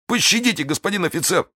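high, desperate pleading; wounded bandit begging the officer; begging for mercy. Acted. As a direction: beg on your knees, voice high. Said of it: "воскликнул разбойник".

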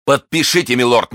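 high, insistent order; urgent demand. A narrator's reading. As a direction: press hard for compliance. Felton presses duke to sign.

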